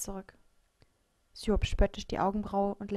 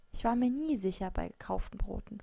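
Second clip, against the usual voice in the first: plain reading voice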